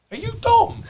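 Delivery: high, loud and laughing, the name bursting out; excited disbelief. Retold or imitated. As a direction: squeal it like you can't believe who's in front of you. Cop suddenly recognises the speaker.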